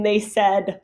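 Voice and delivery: usual speaking voice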